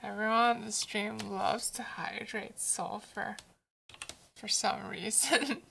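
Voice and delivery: soft whispered aside; hushed teasing aside. Real, not acted. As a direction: say it under your breath to chat. Whispered aside about chat.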